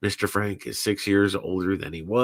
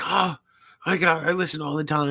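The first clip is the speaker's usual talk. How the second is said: high, fast, eager gush; lightly voiced excited fan; 6 semitones higher than usual. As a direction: imitate her rushing to say she's a listener. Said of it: "She's like".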